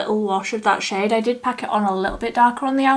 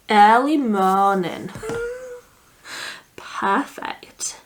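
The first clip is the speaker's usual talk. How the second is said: slow, broad Yorkshire vowels; Yorkshire accent; 3 semitones higher, slower than usual. Pretend. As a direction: say it like a Yorkshire local. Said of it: "In a Yorkshire accent".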